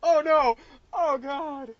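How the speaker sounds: loud, high, through big laughter; helpless laughing disbelief; laughing dismay. Real, not acted. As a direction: groan it while cracking up. Laughing in dismay at monster name.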